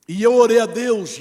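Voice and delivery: ordinary talk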